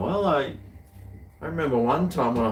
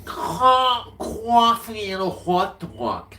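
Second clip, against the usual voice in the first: high, slow New York drawl; New York accent; 12 semitones higher, 5 dB louder, much slower than usual. Pretend. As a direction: stretch it out Big Apple style. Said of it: "in a New York accent".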